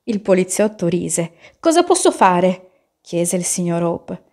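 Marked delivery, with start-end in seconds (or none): 1.6-2.9 high, loud anxious question; raised, worried storybook voice; alarmed question. A narrator's reading. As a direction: plead for advice in alarm. Frightened man asks police for help.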